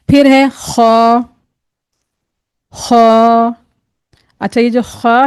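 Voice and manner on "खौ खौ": खौ held long and loud, heavy and rounded; a thick, throaty Arabic खौ; full-mouth kho. Shown as a demonstration. Teacher models the letter for learners, long heavy खौ. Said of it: "मोटा पढ़ा जाने वाला".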